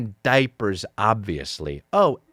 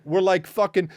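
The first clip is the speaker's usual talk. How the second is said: usual speaking voice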